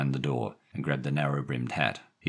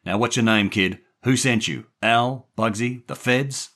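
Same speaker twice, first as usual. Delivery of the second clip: louder, higher rapid-fire questions; angry interrogation; 8 semitones higher, 6 dB louder than usual. A narrator's reading. Jerry grilling the boy about who sent him, angry. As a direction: fire off each name as an accusation.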